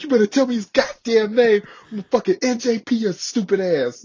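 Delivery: loud, high imitated threat; cussing out. Sergeant major threatening to punish the Marine, imitated angry yelling. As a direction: tear into them like a sergeant major. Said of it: "He's cussing us out".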